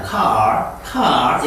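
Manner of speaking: car with a curled, rolled-back r; 혀를 구부린 미국식 car; American r in car. Shown as a demonstration. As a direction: bend the tongue for the r in car. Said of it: "미국에서는 R 발음을 정확히 해줍니다".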